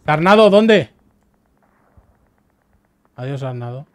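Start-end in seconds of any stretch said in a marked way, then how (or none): 0.1-0.9 raging gamer. Real, not acted. Gamer shouting at teammate mid-fight.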